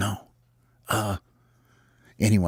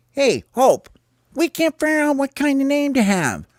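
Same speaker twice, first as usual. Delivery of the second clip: high, loud whiny call; squeaky frustrated player; complaining bird character; 3 dB louder than usual. Acted. Calls across to rival captain.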